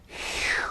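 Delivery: breathy whispered phew of relief; relieved phew. Pretend. Relief after long expansion.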